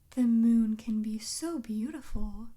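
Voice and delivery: ordinary delivery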